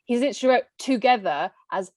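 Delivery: ordinary talk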